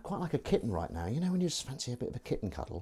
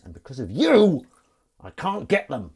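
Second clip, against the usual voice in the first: loud, higher accusing outburst on "you"; mock scolding; 5 semitones higher, 9 dB louder than usual. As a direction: play-act blaming them, voice raised. Acted message scolding the band.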